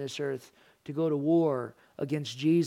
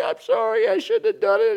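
imitating a whining child, much higher and louder; mock tearful child pleading sorry; crying kid; 5 dB louder than usual. Preacher mimics a sorry child, high mock crying. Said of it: "the kid is crying".

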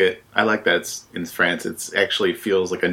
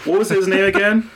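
high-pitched forgetful professor; Professor Dogwood; 10 semitones higher than usual. Pretend. Professor forgetting his grandson's name, high professor voice. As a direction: ask in a high put-on professor voice. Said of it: "keep talking like that".

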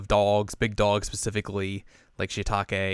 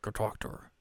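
whisper, urging; hushed push to go talk; whispered nudge; 12 dB quieter than usual. Retold or imitated. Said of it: "pushes her forward".

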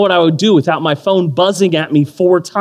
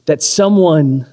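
ordinary delivery

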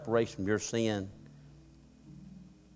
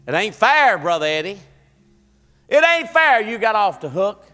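loud, raised-pitch objection; acted indignant protest; 9 semitones higher, 12 dB louder than usual. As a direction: protest loudly like an aggrieved objector. Acts out an objector's complaint.

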